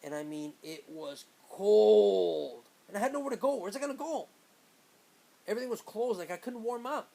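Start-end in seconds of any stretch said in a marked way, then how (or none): 0.6-2.6 "cold" hugely stretched and loud; emphatic COLD. Real, not acted. Emphasizing how freezing the wait was. 2.9-7.1 high-pitched, worked-up retelling; exasperated helplessness. Real, not acted. Recalling being stranded in the cold.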